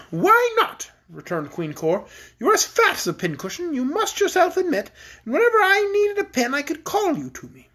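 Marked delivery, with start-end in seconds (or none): 0.1-0.9 high-pitched, airy royal retort; cruel queen. Acted. 2.4-7.7 high-pitched queen toying with the prisoner; cruel queen, teasing. Acted.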